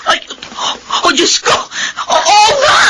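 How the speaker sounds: high, crying shouts of Anak!; panicked sobbing cries. Acted. Mother finds her baby has stopped breathing, crying out in panic.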